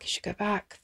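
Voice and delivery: zachter herhaald, in zichzelf mompelend; muttering to herself. Retold or imitated. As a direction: herhaal het zachtjes, alsof tegen jezelf. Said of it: "she talked to herself as she drove".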